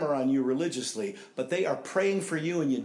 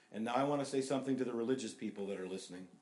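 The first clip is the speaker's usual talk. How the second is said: ordinary talk, nothing marked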